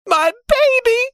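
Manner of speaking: very high, panicked wail; terrified mother crying out for her child; frantic mother's cry. A narrator's reading. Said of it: "her mother cried".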